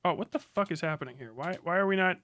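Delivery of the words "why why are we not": ordinary talk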